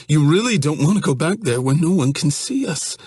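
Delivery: high, panicky, pleading voice; whiny scared young man. Acted. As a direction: voice him as a frightened, whining guy. Johnny begs Evan to stop.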